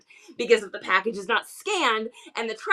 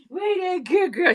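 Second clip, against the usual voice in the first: louder, quicker, higher praise; perky praise; 6 semitones higher, 4 dB louder, faster than usual. Real, not acted. To her pet after the treat, perky praise. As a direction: cheer the pet on brightly.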